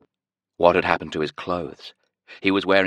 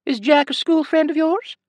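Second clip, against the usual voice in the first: very high sing-song question; curious high nurse; Scottish nurse voice; 5 dB louder than usual. A narrator's reading. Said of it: "a heavy Scottish accent and a high-pitched sing-song voice".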